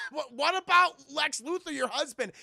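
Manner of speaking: high, loud stammered question; flustered protest. Retold or imitated. Clark reminding Desiree of her husband, flustered loud stammer. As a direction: stammer it out in mock alarm.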